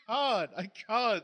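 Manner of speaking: high, breaking into laughter; can barely speak for laughing; cracking up. Real, not acted. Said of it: "I can't even think of the words without cracking up".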